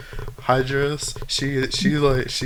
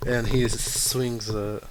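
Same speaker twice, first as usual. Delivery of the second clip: usual speaking voice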